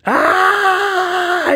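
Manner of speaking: very high shrieking wail; high wailing yell. A narrator's reading. Boy mortified at the mall, shrieking wail. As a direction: let out an anguished high cry. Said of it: "yelled Andrew".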